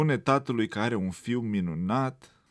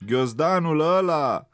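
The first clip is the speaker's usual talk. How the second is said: loud, higher jeering voice; mocking taunt; 7 semitones higher, 6 dB louder than usual. A narrator's reading. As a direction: sneer at the little rabbit. Bully mocks rabbit's schoolbag.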